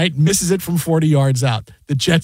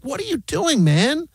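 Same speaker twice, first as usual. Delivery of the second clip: higher, indignant scolding; exasperated rant; 9 semitones higher than usual. Real, not acted. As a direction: grill the coach in disbelief.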